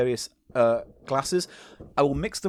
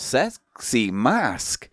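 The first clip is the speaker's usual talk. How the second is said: drawn-out; much slower than usual. Shown as a demonstration. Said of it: "repeats slowly, drawn out".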